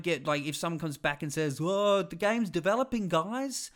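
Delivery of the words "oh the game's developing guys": high, lightly cartoonish defender; chirpy cartoon defender, pitched up; a hint of a cartoon voice. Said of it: "I gave them a cartoon voice".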